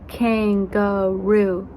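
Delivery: "kangaroo" slowed into three beats; KAN, GA, ROO, evenly drawn out; split into syllables. Shown as a demonstration. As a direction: separate each syllable of "kangaroo". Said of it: "How many sounds do you hear".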